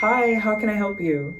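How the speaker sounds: bright teller voice. Acted.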